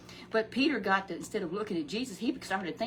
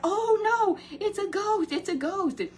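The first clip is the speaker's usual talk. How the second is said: mock panicked cry, much higher and louder; frightened cry; 12 semitones higher, 7 dB louder, slower than usual. Disciples in the boat spot a figure, panicked cry. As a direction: squeal it like scared fishermen seeing a ghost. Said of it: "they cried out".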